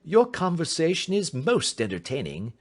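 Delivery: high, lightly amused detective; amused politeness. Acted. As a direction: needle him with courteous good cheer. Holmes amused by the insults.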